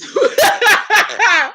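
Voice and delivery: high burst of laughter; cracking up. Real, not acted. Host breaking up mid-impression, high laughter. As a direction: explode into giggles mid-sentence.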